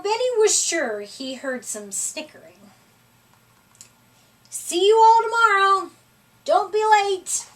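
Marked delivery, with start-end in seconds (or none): 4.5-7.4 calling out cheerfully. A narrator's reading. Benny calling out to animals.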